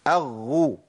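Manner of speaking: أغو with a pressed, voiced double gh; breath held back. Shown as a demonstration. Teacher showing how to block breath, carefully articulated. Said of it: "أضغط على مخرج الحرف وأمنع عنه النفس".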